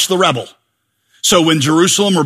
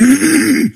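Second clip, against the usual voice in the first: mock impatient throat-clearing; pointed throat clear; 8 semitones higher than usual. Imitating giver at offering bucket.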